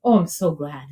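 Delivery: usual speaking voice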